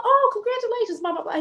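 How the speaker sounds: high-pitched congratulatory imitation; gushing nurse. Nurse congratulating newlyweds, imitated high gushing. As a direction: coo it high, then skim off.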